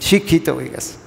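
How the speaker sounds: mocking taunt. Villagers taunting someone who speaks proper Bengali, mocking jibe. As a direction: tease it like snickering at a show-off. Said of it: "টিটকারি মারার বিষয়".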